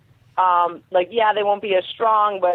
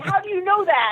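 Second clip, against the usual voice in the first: high worried question; 10 semitones higher than usual. Retold or imitated. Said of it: "He was like".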